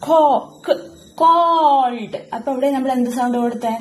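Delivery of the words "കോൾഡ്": കോൾഡ് drawn out with a hard k and a laugh; slow laughing demo. Shown as a demonstration. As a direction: stretch കോൾഡ്, hard k first. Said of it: "ഇവിടെ കണ്ടോ". (കോൾഡ് കടുപ്പമുള്ള ക് ശബ്ദത്തോടെ)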